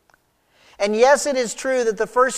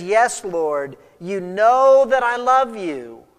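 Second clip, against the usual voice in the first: wounded insistence, louder on "know"; very hurt tone; 6 dB louder, slower than usual. Acted. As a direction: plead it slowly, hurt at being doubted. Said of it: "responds in what I believe is a very hurt tone".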